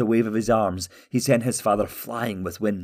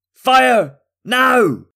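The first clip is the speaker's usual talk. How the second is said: very high, loud battle command; shouted order; 10 dB louder than usual. A narrator's reading. King ordering five men to loose arrows, shouted. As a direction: shout each word as an urgent order.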